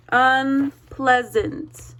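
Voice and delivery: Unpleasant drawn out slowly, syllable by syllable; teacher leads the class in Unpleasant, slowly. Shown as a demonstration. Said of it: "Everybody say that out loud with me".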